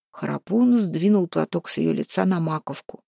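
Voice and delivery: usual speaking voice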